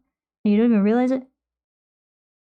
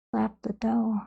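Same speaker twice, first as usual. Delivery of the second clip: breathy playful whisper; 8 dB quieter, slower than usual. Acted. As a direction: whisper it like a little secret. Playful aside after bonking mic.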